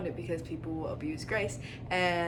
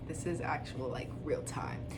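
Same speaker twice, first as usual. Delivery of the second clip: soft, low whisper under the breath; whispered aside; 6 semitones lower, 11 dB quieter than usual. Real, not acted. Aside while searching the Bible.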